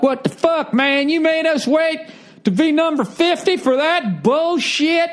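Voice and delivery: high-pitched mock complaint; hammed-up high whine of a heckler; disgruntled audience member. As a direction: gripe like an annoyed fan in the crowd. Acts complaining member of crowd.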